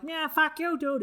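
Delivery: high mock yell; Bill Burr fun anger. Comic imitating Bill Burr's fun angry rant, high mock yell. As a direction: bark it out like a gleeful angry comic. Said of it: "like Bill Burr".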